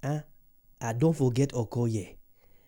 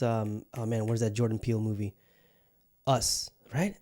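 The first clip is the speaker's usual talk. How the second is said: ordinary talk, nothing marked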